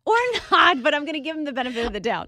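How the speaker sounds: loud, fast, laughing burst. Real, not acted. Joking with the host about the rude stranger, laughing.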